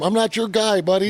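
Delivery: high, loud mock Canadian retort. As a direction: yap it high like a cranky Canadian. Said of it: "You should have looked at him".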